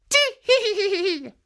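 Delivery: high, loud tittering giggle; old woman giggle. Acted. Old woman hiding behind the sleeve giggling high. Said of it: "laughed the old woman".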